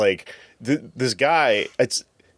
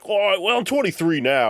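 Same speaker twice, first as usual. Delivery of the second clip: higher, breezy mock British lad; 10 semitones higher than usual. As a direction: play the carefree Brit, pitched up. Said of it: "And he's like".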